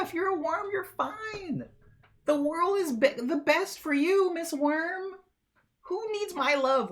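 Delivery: high, stretched mock dumping of a worm; high mock rant. Streamer mock-breaking up with a worm partner, high and scoffing.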